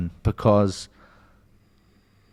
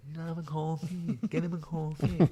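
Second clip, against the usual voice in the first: begging; 7 semitones higher, 12 dB quieter than usual. Retold or imitated. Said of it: "I was begging him".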